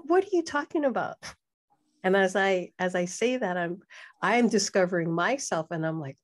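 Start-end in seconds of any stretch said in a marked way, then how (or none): 0.0-1.1 pitched way up in disbelief, quick; a fast, high what-are-you-talking-about; incredulous. Retold or imitated. Retelling the reply to husband, high and incredulous.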